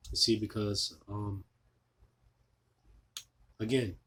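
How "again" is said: usual speaking voice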